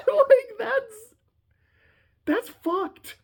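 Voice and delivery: laughing. Real, not acted. Laughing disbelief after yell.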